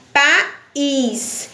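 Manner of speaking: país, stretched slowly with the weight on "ís"; stress on final syllable. Shown as a demonstration. Teacher showing pupils the strong syllable in país, slow and stressed. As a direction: draw out país and lean hard on the "ís". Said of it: "o is ou o mais forte".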